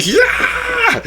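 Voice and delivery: very high mock scream; imitated scream. Imitating Melissa as the truck drops before her, mock scream. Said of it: "Melissa screaming".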